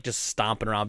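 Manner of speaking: normal talking voice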